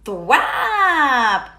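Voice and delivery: ordinary delivery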